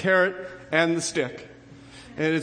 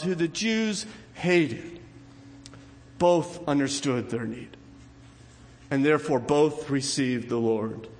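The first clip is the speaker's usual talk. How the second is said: normal talking voice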